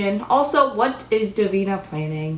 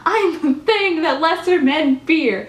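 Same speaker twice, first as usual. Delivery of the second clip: higher, put-on grand villain voice; hammed-up menacing boast; Klaus's boast; 7 semitones higher than usual. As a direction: declaim it like a proud ancient vampire. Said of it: "He's like".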